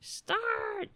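very high squeaky cat complaint, slowing at the end. Retold or imitated. Host voicing the cat scolding him, high squeaky cat voice. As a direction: voice the cat whining back at you. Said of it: "He's like".

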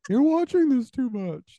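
high, nagging mother voice; mock mom. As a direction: lecture him like a worried mom.

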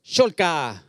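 loud call in a strange tongue; foreign street shout. Acted. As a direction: shout it out like a foreign crowd calling. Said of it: "så ropte de". (utenlandsk tilrop, ropt høyt)

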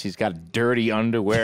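ordinary delivery

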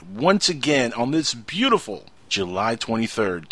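normal talking voice

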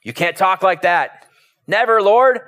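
high-pitched, protesting reproach; rebuking. Acted. Peter takes Jesus aside to correct him, rebuking. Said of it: "began to rebuke him".

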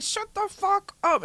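high, slow furious mom; mom snapping. Retold or imitated. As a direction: bite each word like a fed-up mother. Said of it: "She'd be like".

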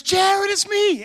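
high, drawn-out cry of recognition; expressive excited greeting. Retold or imitated. Expressive man greeting the preacher at the door, excited. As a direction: imitate a thrilled old acquaintance calling out. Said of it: "he's so expressive".

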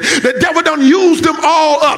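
a pastor driving the message home to the congregation; full-force preaching. Acted.

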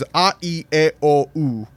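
the five pure Spanish vowels recited one by one; short, separate "a, E, I, O, U" vowels, Spanish style. Shown as a demonstration. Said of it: "Spanish only has five".

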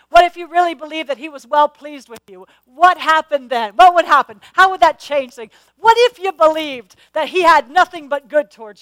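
loud, rising preacher's challenge to the church; full-force preaching. Real, not acted. To the congregation, urging them to believe, full-force preaching.

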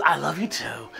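put-on soft child's voice, I love you too; child's tender reply. Son answering his father tenderly. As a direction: answer softly like a small boy. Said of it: "there's a moment when he goes".

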